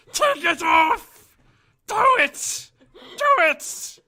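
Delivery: loud, slow, high creature shout; Gollum shouting. Shown as a demonstration. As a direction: yell it like a frantic creature. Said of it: "read something in his Gollum voice".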